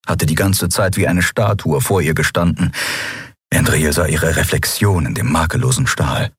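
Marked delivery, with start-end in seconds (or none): none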